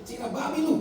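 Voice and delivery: pretend insult. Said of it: "Coba bilang dulu".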